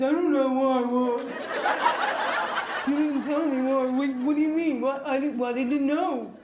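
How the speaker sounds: put-on voice of a baffled husband. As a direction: mimic a clueless husband. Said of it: "We all have that same husband voice".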